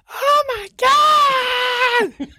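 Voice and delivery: very high, drawn-out mock shriek; imitated wail of panic; retold panicked scream. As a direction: screech it slowly, way up high. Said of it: "he starts screeching off".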